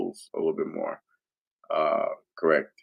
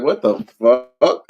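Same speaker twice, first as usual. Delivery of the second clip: a startled slowed question, a little louder; 4 semitones higher, 8 dB louder, slower than usual. Retold or imitated. Hearing strangers in his house, startled. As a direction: react like you heard someone in your house. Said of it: "I was like".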